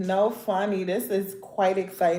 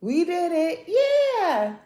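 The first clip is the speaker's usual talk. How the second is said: much higher sing-song cheer; Dora the Explorer; 8 semitones higher, slower than usual. Retold or imitated. Vlogger celebrating her nails like Dora the Explorer, high sing-song cheer. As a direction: cheer like a cartoon kid celebrating. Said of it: "Like Dora the Explorer".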